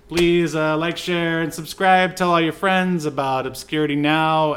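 upbeat announcer sign-off, lifted pitch; cheerful host plug at the end of the show; podcast outro plug. Acted. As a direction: sell the like-and-subscribe.

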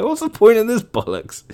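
high, mock-outraged scoff; exasperated scoff. Venting about useless dream books, high exasperated scoff. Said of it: "I was like".

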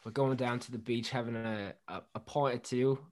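soft, quiet Australian attempt; Australian accent. Shown as a demonstration. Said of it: "do an Australian accent".

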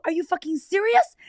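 high, loud, incredulous yell; loud outraged scream. Retold or imitated. Screaming back at husband who said go to bed, outraged yelling. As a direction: scream the question in disbelief. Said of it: "I'm about to get loud".

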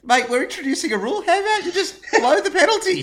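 high, loud, laughing exasperation; laughing rant. Real, not acted. Laughing mock rant at rule.

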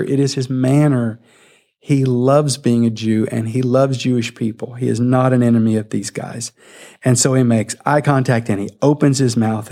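ordinary talk

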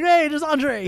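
high mock-excited call; excited recognition. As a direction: call out as if spotting him on TV. Acting out spotting Andre on TV.

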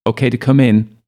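high, loud call from behind; shout. A narrator's reading. Ailsa calling in from behind, high and loud. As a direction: call into the tight fissure. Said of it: "Ailsa shouts".

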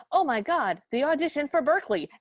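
hammed-up sudden realization; mock panic. Everyone remembers the audition and flees, mock panic.

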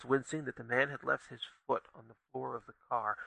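ordinary delivery

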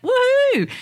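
high, drawn-out whoop of joy; overjoyed. Acted. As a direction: whoop like an excited child jumping around. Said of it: "They jump around for joy".

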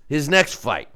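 usual speaking voice

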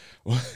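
short burst of laughter. Real, not acted. Laughing at the job offer to move abroad.

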